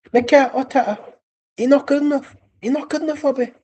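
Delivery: put-on amateur Jamaican accent, very high voice; yard man impression. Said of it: "That's my yard man impression".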